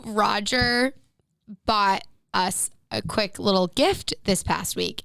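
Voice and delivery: normal talking voice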